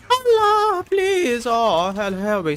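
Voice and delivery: high, loud cries for help; frantic high shriek easing down; panicked shouting. Real, not acted. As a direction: yell a plea in gaming panic. Crying out in panic during gameplay.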